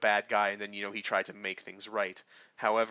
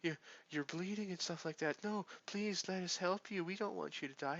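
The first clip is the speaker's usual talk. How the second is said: much higher, softer mock plea to the dying villain; mocking pleading girl; 9 semitones higher, 6 dB quieter than usual. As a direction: coo it like a naive girl begging. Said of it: "And she's like".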